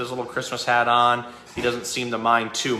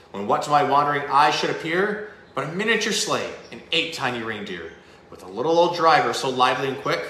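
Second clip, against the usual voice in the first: lively Christmas story read aloud, building in energy; storybook reading; 4 semitones higher than usual. A narrator's reading. Host reading The Night Before Christmas to viewers, storybook narration. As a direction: read the classic poem like a bedtime story.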